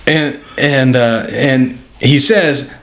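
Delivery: normal talking voice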